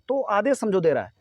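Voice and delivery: ordinary talk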